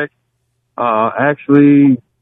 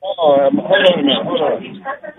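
phone held away. Real, not acted. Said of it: "sounds like you're far away from the phone".

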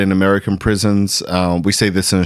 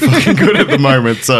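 laughing; 6 semitones higher, 6 dB louder than usual. Real, not acted. Laughing through the joke.

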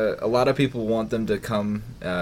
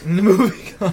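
laughing; 6 semitones higher, 6 dB louder, slower than usual. Real, not acted. Co-host echoing the dodge, laughing. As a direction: echo it through a laugh.

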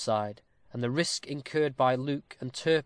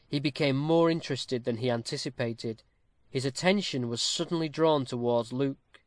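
usual speaking voice

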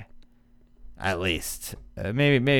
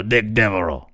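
Ed Orgeron. Acted. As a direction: put on the Cajun coach, pitched down. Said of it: "say it like Ed Orgeron".

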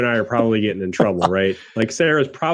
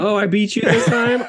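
laughing; 8 semitones higher than usual. Real, not acted. Teasing friend finishing beer first.

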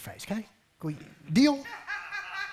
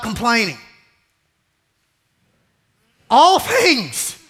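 preaching at full force; 8 semitones higher, 11 dB louder than usual. Acted. Preacher pressing a verse on the congregation. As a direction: hammer home the verse.

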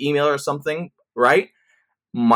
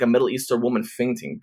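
normal talking voice

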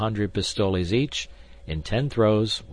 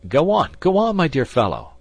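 loud, very high, agitated; exasperated urging; 11 semitones higher, 9 dB louder than usual. A narrator's reading. D'Artagnan pressing the indifferent gambler to continue, exasperated. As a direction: urge him on, at the end of your patience.

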